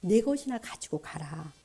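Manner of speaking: low, soft, understated rebuke; quiet reproach. Acted. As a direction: send them off with subdued firmness. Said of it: "책망 섞인 말씀을 하시는데요".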